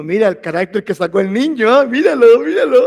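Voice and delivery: loud boastful dad showing off his son; proud laughing father. Retold or imitated. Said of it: "O se ríe diciendo". (papá orgulloso presumiendo, en voz alta)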